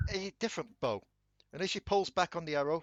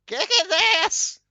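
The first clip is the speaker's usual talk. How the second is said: high, loud excited yell; cheering shout; 11 dB louder than usual. Retold or imitated. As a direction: cheer from the crowd. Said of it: "bloody Helena be like".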